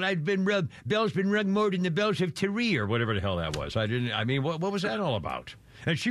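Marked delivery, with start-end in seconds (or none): 0.0-2.8 higher-pitched Irish accent imitation; rough take-off of an Irish accent; mock Irish accent. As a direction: mimic the waitress's Irish accent. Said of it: "why did they have her the whole".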